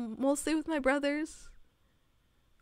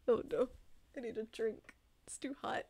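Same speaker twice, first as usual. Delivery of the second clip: ordinary delivery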